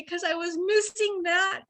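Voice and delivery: fighting back tears of excitement; emotional, near tears. Real, not acted. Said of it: "I'm getting emotional 'cause I'm super excited".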